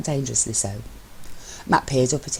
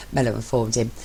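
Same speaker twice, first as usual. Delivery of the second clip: usual speaking voice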